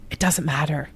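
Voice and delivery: soft, unvoiced whisper; whispered aside. Real, not acted. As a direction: drop to a secret whisper. Aside after "if it works.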